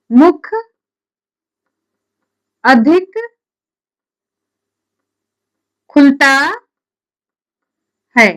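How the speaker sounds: loud, one word at a time; slow dictation. Real, not acted. Teacher dictating a definition for students to write, slow dictation. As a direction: dictate loudly with long gaps between words.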